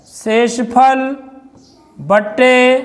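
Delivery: शेषफल stretched very slowly while writing; drawn-out dictation. Shown as a demonstration. Teacher writing the formula for pupils: शेषफल very slow. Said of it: "teacher dictating formula".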